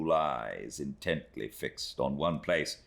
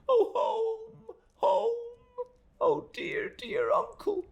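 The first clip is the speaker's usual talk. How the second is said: put-on much higher, crying young woman, breath breaking into whisper; sobbing; much slower than usual. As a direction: weep out for home and uncle. Emily weeps.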